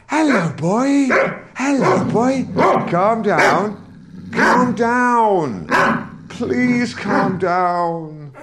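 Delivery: high, strained placating voice to a dog; scared and upset. Acted. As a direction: sound frightened to calm a fierce dog. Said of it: "if I sound scared and upset".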